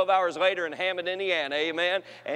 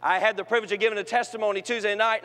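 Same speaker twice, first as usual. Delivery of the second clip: ordinary talk, nothing marked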